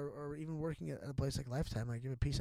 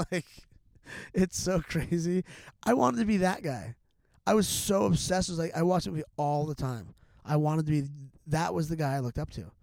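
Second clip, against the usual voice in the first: excited gushing; 2 semitones higher, 6 dB louder than usual. Real, not acted. Guest confessing childhood obsession with a movie hero, excited. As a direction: get carried away with enthusiasm.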